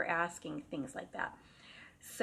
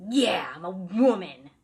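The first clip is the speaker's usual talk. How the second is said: loud, slow, gruff man's voice; husky deeper voice; 11 dB louder than usual. Retold or imitated. Angry spirit answers the friends through the sister, loud and gruff. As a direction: bark it back like an angry old spirit. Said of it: "answering in a husky, deeper voice".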